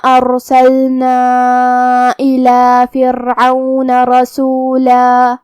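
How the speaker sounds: very slow, drawn-out melodic tilawat; Quran recitation. Acted. Reciter performs Surah al-Muzzammil for listeners, very slow chant. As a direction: chant every word slowly and lingeringly.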